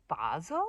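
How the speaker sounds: "basil" as BAH-zul; customer's pronunciation. Retold or imitated. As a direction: open ah in "basil". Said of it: "people at the farmer's market who, who say".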